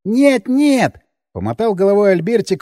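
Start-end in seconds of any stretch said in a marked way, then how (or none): 0.0-1.0 high, indignant no from the simple man; a hint of outraged man-child; indignant Albertik. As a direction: protest the wrong retelling. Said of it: "возмущённый".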